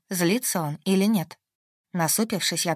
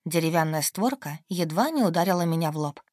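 plain reading voice

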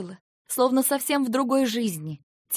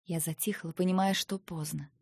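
hushed resignation; 3 semitones lower, 7 dB quieter than usual. A narrator's reading. Heroine goes still, resigned.

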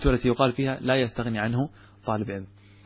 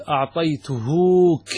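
drawn-out, louder wrong example أعطيتهوك; slow wrong form; 2 semitones higher, 4 dB louder, much slower than usual. Shown as a demonstration. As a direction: stretch أعطيتهوك to show the error. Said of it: "فلا تقول".

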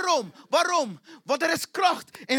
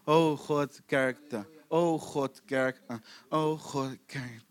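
low droning worship by rote; mechanical, low-pitched drone; monotone; 11 semitones lower, 4 dB quieter, much slower than usual. Acted. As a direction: chant it lifelessly, fading out. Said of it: "laat het niet monotoon worden met God".

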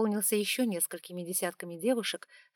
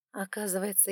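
ordinary delivery, nothing marked

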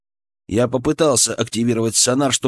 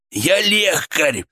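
slow, strained, higher declaration; hoarse wheeze; 5 semitones higher, much slower than usual. A narrator's reading. Healer refusing to let go, strained hoarse protest. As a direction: force it out slowly with strain. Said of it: "просипел я".

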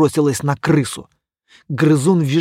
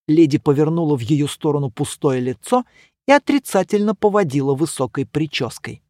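ordinary delivery, nothing marked

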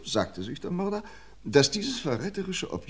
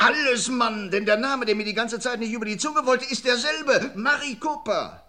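much higher, eager and a bit louder, with a short laugh; excited revelation; 12 semitones higher, 3 dB louder than usual. Acted. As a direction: let the discovery spill out, grinning. Detective explains the clue.

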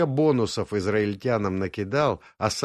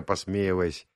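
usual speaking voice

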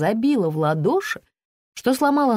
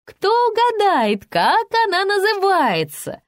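high sing-out question; stage host asking; 13 semitones higher, 4 dB louder than usual. A narrator's reading. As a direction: call it out brightly to the hall once more. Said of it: "в третий раз спросила Лиза".

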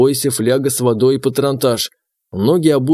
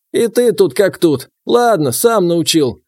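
put-on high, slow grumbling old voice; grumbling old man; 6 semitones higher, 3 dB louder, slower than usual. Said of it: "Проворчал егерь".

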